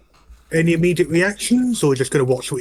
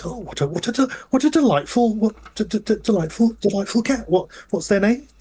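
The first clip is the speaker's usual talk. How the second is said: higher, lightly acted polite delight; gushing conductor; 8 semitones higher than usual. Conductor admiring a passenger's cat, gushing politely.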